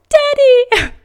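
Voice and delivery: put-on very high little-kid whine; whining to Daddy. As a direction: act out a pitiful hurt child. Acted child's cry to dad.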